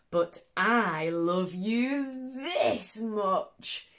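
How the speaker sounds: slow, tender and drawn out; daddy hare voice. Acted. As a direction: stretch each word slowly like a loving father. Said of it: "he said".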